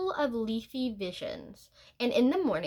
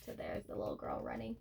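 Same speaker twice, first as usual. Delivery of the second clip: murmured under the breath, fast; whispered aside; 13 semitones lower, 14 dB quieter, faster than usual. Real, not acted. Storyteller whispering an aside to the children. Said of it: "aside while showing pictures".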